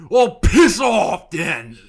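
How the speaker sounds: slow, loud shouted piss off; drunk shout. Retold or imitated. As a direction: bellow it like a drunk lad. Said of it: "it's like".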